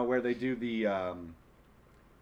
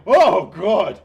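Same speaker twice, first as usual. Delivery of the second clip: alarmed exclamation; 10 dB louder than usual. Retold or imitated. Imitating horrified reaction.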